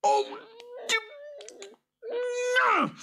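very high, spluttering creature wail; fuming boggart. A narrator's reading. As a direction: splutter in frustrated rage, with pauses. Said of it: "The boggart was fuming with anger".

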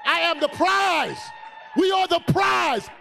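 high, slow, shouted claim. Real, not acted. Preacher rousing the ladies to clap, shouted slowly. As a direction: shout it out for the crowd to hear. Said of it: "Come on".

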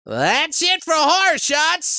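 high, slow showman sign-off; announcer outro. Acted. Host closing the segment like a showman. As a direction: draw it out like a game show host.

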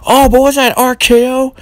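high, sudden "was that R K O?"; stunned ringside surprise; surprised call. Acted. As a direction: gasp out the question like a shocked announcer. Surprised at a move.

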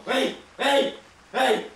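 sharp warning call. Real, not acted. Player warning the horror figure, calling sharply. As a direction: call out to freeze someone in place.

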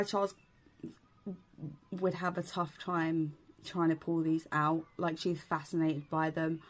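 talking through snap-on teeth; slight lisp from dental appliance; speaking with clip-on veneers. Real, not acted. Seen 3: Bright uniform clip-on veneers on teeth; she tugs them at 4.3.

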